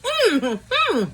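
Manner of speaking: high, loud savouring "mm-hmm"; astonished food moan. Real, not acted. Taster reacting to the first bite, astonished moan.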